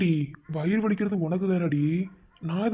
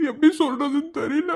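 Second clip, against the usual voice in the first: higher, louder, crying through words; tearful breakdown; 4 semitones higher, 4 dB louder than usual. Acted. Grieving friend tells her of his fear, crying.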